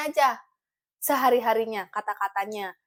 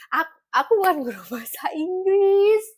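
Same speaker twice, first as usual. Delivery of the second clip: laughing; 8 semitones higher, 4 dB louder than usual. Real, not acted. Menolak jadi guru, sambil tertawa.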